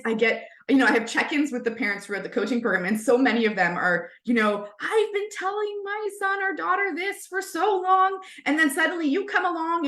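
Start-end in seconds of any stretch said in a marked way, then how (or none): 4.8-9.9 higher, lightly voiced weary parent; imitating exasperated parent. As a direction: play a parent who is tired of repeating. Imitating exasperated parents at check-ins.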